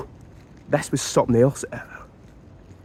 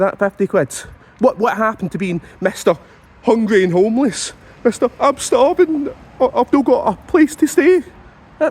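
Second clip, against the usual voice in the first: high, louder, indignant shouting; furious rant; 9 semitones higher, 3 dB louder than usual. Acted. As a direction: blow up at him in mock outrage. Ranting at homeless guy.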